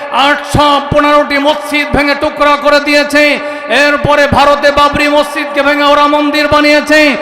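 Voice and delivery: building from steady to high shouting sermon; preaching at full force. Real, not acted. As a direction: rouse the crowd, climbing to a roar.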